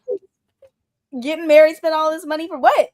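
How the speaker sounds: high, loud disbelief; incredulous. Retold or imitated. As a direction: ask it in disbelief. Indignant reaction to wedding.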